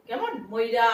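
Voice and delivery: ordinary talk, nothing marked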